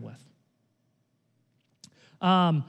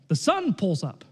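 ordinary talk, nothing marked

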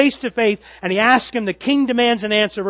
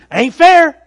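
mock complaint; 10 semitones higher, 15 dB louder than usual. Voicing Mephibosheth's imagined complaint.

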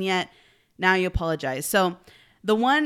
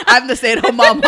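laughed out, much louder and higher; laughing; 5 semitones higher, 13 dB louder than usual. Real, not acted. Joking with her cohost about being home mornings, laughing loudly. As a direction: laugh through the admission.

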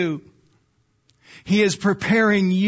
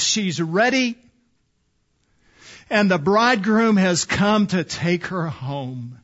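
ordinary talk, nothing marked

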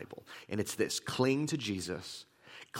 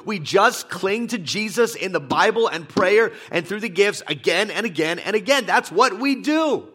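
loud, higher, emphatic pulpit delivery; full-force preaching; 7 semitones higher, 9 dB louder than usual. Acted. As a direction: build into a rousing sermon cry.

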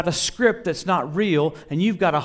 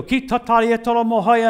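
higher, louder mock burst of tongues; raised, punchy preacher imitation; Mac Hammonds; 5 semitones higher, 5 dB louder than usual. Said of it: "Like Mac Hammonds, he'd go".

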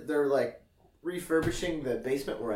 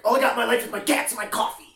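fast and higher, a touch of the tense tough guy; intense guy quote; 7 semitones higher, 6 dB louder, much faster than usual. Retold or imitated. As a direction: rattle it off like a raw-nerve New Yorker. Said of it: "he's like".

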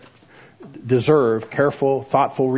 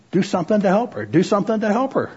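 lightly voiced inner prompting, higher pitched; mildly urging, pitched up like a nagging thought; urgent inner voice; 7 semitones higher than usual. Voice inside counselee urging her to help crying friend, mildly insistent. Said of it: "like a voice was saying inside me".

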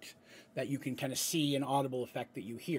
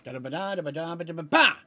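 rattled-off spell chant, final "ba" punched loud; explosive last syllable; 7 dB louder, faster than usual. Shown as a demonstration. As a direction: burst out on the last "ba" of "ba-da-ba-ba". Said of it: "that last syllable is the release".

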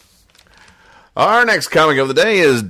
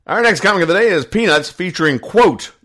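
bright announcer; 9 semitones higher than usual. Real, not acted. Announcing next comic brightly.

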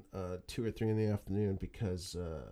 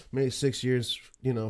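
ordinary delivery

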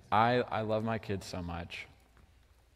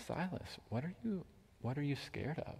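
hushed dad voice, lifted and caring; tender father; 3 semitones higher, 15 dB quieter than usual. Retold or imitated. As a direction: coax a scared child softly. Father gently asking scared son.

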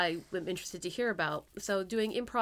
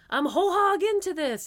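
high, loud burst of mock eagerness; gung-ho newcomer; 9 semitones higher, 8 dB louder than usual. Host imagines eager new improvisers, gung-ho. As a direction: play someone diving in with both feet.

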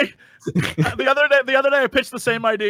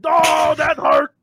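higher crying ogre shout; pained ogre voice; 7 semitones higher, 4 dB louder, slower than usual. Acted. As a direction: wail it like a hurt ogre. Ogre role-play fight.

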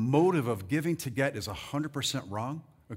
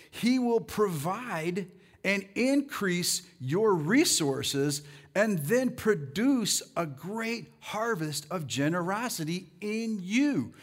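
very slow, raised scripture reading; slow emphatic reading; 4 semitones higher, much slower than usual. Shown as a demonstration. Preacher reading the promise to congregation, slow emphatic reading. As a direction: draw out each phrase of the promise.

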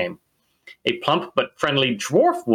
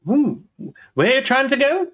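high, excited dwarf woman, much higher; dwarf barkeep voice; 12 semitones higher than usual. Acted. Innkeeper answering the traveler in a high dwarf voice. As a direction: squeal it like the cheery innkeeper. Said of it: "her voice".

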